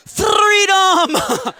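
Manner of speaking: high, loud, laughing cry of Freedom; joyful shout. Retold or imitated. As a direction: yell it like a kid set free. Son shouts after touching towel.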